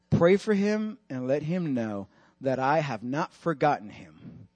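low, slow, soft voice of God; hushed divine reassurance; solemn, tender message. Retold or imitated. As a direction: deliver it gently and gravely, fading away. God's tender message, retold.

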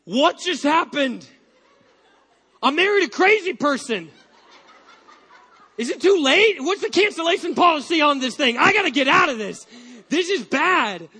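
loud, high, laughing mock panic; hammed-up horror at her new husband; panicked new wife. Wife sitting up in bed, loud mock panic. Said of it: "[name]'s sitting up going".

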